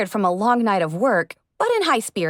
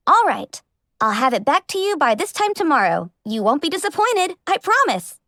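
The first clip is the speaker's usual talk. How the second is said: higher and higher, rushing eager promise; 5 semitones higher than usual. Acted. Young fan promising her idol a perfect repair, excited.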